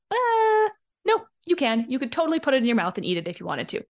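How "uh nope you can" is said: much higher and louder, a sharp no-nonsense rebuttal; an incredulous, teasing correction; sassy retort. Real, not acted. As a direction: cut the excuse down, bright and loud. Retorting to the mock whine.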